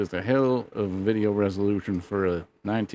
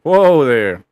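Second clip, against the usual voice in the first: loud, high startled whoa there; outraged patriot yelling whoa; shocked whoa; 6 semitones higher, 10 dB louder, slower than usual. Pretend. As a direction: rein him in, scandalised and loud. Streamer hears guest is vegetarian.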